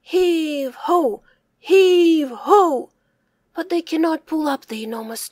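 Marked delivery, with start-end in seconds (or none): none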